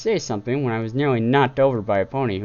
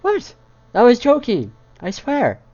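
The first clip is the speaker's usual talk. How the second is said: startled protest; 14 semitones higher, 7 dB louder than usual. Acted. Princess startled by silence.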